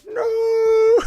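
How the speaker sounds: high, loud, hammed-up cry of despair; mock anguished scream. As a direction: scream like his pick was sniped. He's screaming.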